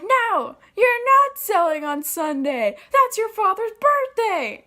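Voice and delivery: high, loud, slowed-down yelled refusal; mom screaming. Retold or imitated. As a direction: shriek it like a mother laying down the law. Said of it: "screaming her brains out at me".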